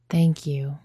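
usual speaking voice

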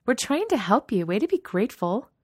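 high, loud, whiny indignation; swooping, affronted scolding; indignant. A narrator's reading. Katie scolds the ungrateful girl, high-pitched and indignant.

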